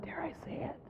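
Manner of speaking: very soft, hushed aside; conspiratorial whisper. Pretend. Speaker daring to call God female, conspiratorial whisper. Feigned caution before bold word.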